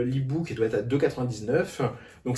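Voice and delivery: ordinary talk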